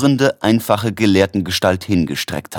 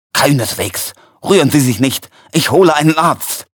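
urgent, rising to a higher pitch; insist, growing more urgent; drängend; 7 semitones higher, 3 dB louder than usual. A narrator's reading. Helper urgently reassures the wounded man. (zunehmend drängend)

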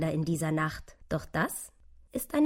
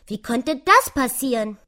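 brummiges Eichhörnchen; 6 semitones higher, 8 dB louder than usual. A narrator's reading. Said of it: "brummte das Eichhörnchen".

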